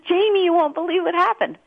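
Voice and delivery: high-pitched, breathless-to-tell excitement; acting out an excited friend; excited rush-up. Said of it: "Gabe stampedes me".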